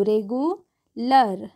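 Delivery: REGULAR drawn out slowly, ending in a short -ler; careful syllable-by-syllable model. Shown as a demonstration. Teacher sounding out a new word for learners, slow model pronunciation. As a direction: sound out रेगुलर slowly, last syllable 'luh-r'. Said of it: "क्या होगी, रेगुलर".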